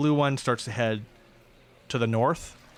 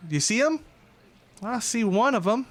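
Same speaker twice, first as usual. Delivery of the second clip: put-on southern accent; 11 semitones higher, 4 dB louder than usual. Shiva calling out to the viper in a Southern accent. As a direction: play it as a Southern henchwoman. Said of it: "I heard that southern accent".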